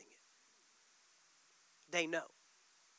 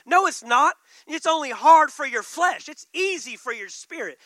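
loud, higher, cutting it down; forceful rebuttal; 3 semitones higher, 12 dB louder than usual. Real, not acted. Pastor rebutting the congregation, loud and high. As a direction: shut the excuse down hard. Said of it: "preacher answers at full force".

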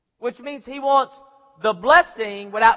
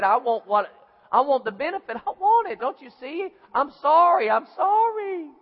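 high, whiny show of remorse; mock tearful begging; 4 semitones higher than usual. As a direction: wail the apology, climbing higher. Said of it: "All those tears".

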